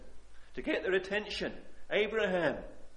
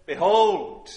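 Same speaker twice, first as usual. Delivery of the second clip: ringing call; 2 semitones higher, 13 dB louder than usual. Acted. Voicing Christ's call to Simon.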